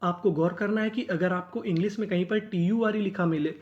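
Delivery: usual speaking voice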